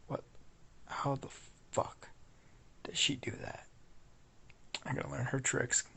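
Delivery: hushed, baffled whisper; whispered disbelief. Acted. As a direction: whisper so she won't wake. Whispering while Annabeth sleeps.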